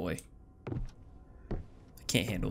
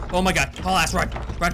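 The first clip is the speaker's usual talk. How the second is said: panicked urging; 5 semitones higher, 4 dB louder than usual. Real, not acted. Fleeing a monster in game.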